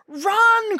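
high, loud call to run; urgent cry. Acted. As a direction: cry out in alarm. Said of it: "cried Iolaus".

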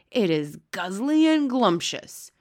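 loud, slow giant's shout; shouted relish. A narrator's reading. Blood bottler defends eating humans, shouting. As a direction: shout it, drawing out each word slowly. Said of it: "shouted the blood bottler".

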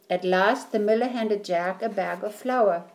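usual speaking voice

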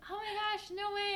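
high-pitched imitation of a gushing stranger; amazed. Coffee shop staff hearing her made-up story, amazed. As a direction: gush in mock amazement.

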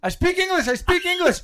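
high, loud laughing panic; panicked pleading. Retold or imitated. Teen pleading with a stranger through a stall door. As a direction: repeat it desperately, half laughing. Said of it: "All I could think of to say was".

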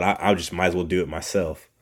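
usual speaking voice

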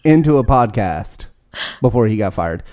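ordinary delivery

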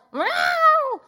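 protesting cat meow. Retold or imitated. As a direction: yowl like an offended cat in protest. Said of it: "she wasn't like".

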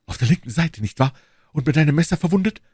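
quick, insistent question rising at the end; urgent interruption. A narrator's reading. Duke interrupting the queen's dream tale, urgent question. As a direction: break in eagerly with lifted endings. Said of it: "unterbrach sie Buckingham".

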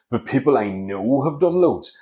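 ordinary talk, nothing marked

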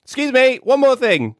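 very high, piping falsetto; pestering woman. Acted. Woman stopping Andrew as he leaves, comic falsetto. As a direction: call out in a silly high voice. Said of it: "she's like".

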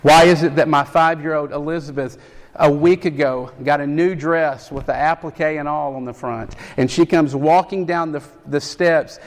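ordinary talk

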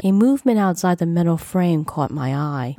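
usual speaking voice

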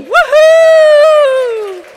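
high shouted woohoo; excited cheer. Real, not acted. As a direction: cheer them loudly. Cheering for Zimbabwe.

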